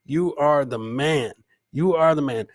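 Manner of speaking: ordinary talk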